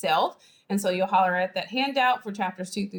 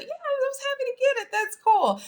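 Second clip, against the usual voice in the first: very high, put-on modest brag; fake nonchalance. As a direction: pretend you are not trying to flex. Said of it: "you're not trying to flex".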